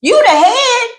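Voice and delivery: high, emphatic challenge; hammed-up exasperation; indignant retort. Heated retort to imagined men.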